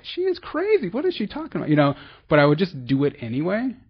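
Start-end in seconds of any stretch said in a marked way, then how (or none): 0.0-1.7 incredulous inner voice. Retold or imitated. Inner eye-rolling reaction to sponsor.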